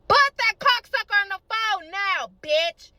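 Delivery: high, loud, slow acted yell; furious demand. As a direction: hammer out each word in anger. Acted furious demand over phone.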